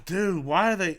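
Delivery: ordinary talk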